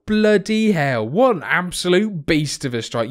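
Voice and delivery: high, loud, stretched-out astonishment; amazed excitement. Real, not acted. Commentator stunned by a young player's goal, thrilled. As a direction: marvel loudly at the strike.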